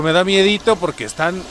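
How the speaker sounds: normal talking voice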